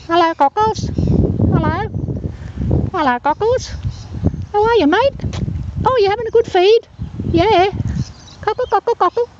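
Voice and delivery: very high sing-song voice for the bird; calling sweetly to a wild cockatoo; bird talk. Real, not acted. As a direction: coo at the cockatoo like a pet. Talking to the cockatoo.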